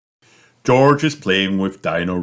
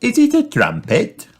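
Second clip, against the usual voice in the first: put-on high curious girl voice; a little girl finding something; Peppa's voice; 4 dB louder than usual. Girl spotting something under a bush, high curious voice.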